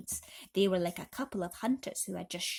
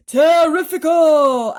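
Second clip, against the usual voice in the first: high, very loud, slow cry; 9 semitones higher, 17 dB louder, slower than usual. A narrator's reading. Aunt cries out over the giant peach, thrilled. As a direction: bellow it in delight. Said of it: "Aunt Sponge cried out".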